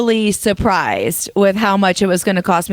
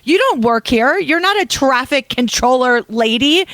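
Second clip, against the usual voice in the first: indignant, higher-pitched telling-off without full screaming; retold angry outburst; 5 semitones higher than usual. Driver telling off a meddling woman in the parking lot, indignant. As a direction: re-enact ranting at someone from behind the wheel. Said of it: "I was swearing and screaming".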